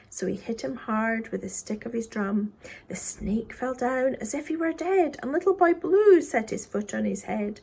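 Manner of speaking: ordinary delivery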